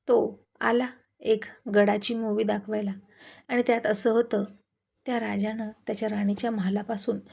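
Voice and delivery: usual speaking voice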